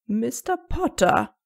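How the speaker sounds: langsam und gedehnt, mahnender Ton; warnend. A narrator's reading. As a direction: eine ernste Warnung aussprechen. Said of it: "warnte McGonagalls Ton". (McGonagall ermahnt Harry mit warnendem Ton)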